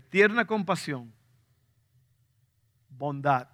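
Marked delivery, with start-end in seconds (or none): none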